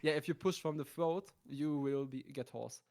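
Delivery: ordinary talk, nothing marked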